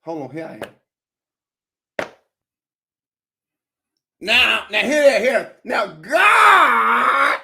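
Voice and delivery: mock preacher building up; preaching. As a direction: hold the congregation then boom. Mock sermon continues.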